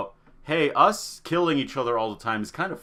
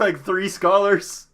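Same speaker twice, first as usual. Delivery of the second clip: usual speaking voice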